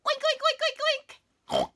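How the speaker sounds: loud, high laughing oinks; pig oinks. Pretend. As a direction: oink like a happy piglet. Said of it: "we get to oink".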